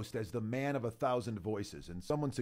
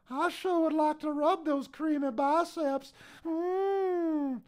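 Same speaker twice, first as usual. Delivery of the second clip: put-on much higher creepy old man voice; Herbert voice; 14 semitones higher, slower than usual. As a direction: purr it like a creepy old neighbour. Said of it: "hey, Mister Herbert".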